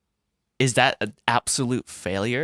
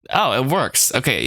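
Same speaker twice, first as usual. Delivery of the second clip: ordinary delivery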